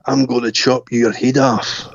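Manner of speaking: put-on menacing older boy. Older boy threatens with hatchet.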